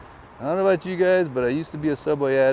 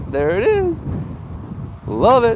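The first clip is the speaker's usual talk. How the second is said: delighted; 8 semitones higher than usual. Real, not acted. Spots a favourite place.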